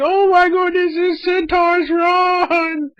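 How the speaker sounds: high, panicked cry of villagers fleeing. Retold or imitated. Villagers warn of a centaur raid, high panicked yell. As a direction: shriek it like frightened townsfolk. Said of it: "They're like".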